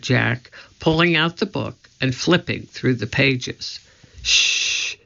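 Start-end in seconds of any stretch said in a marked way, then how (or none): 4.2-4.9 hushing. Acted. Annie hushing Jack as someone approaches. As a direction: hush him so no one hears. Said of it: "said Annie".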